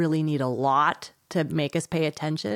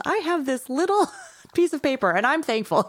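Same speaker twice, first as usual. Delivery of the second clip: higher, laughing put-on kid voice; high, slightly louder giggly child impression; mock thankful kid; 8 semitones higher, 3 dB louder than usual. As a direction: play the grateful child with a laugh in it. Mock kid quote on gratitude.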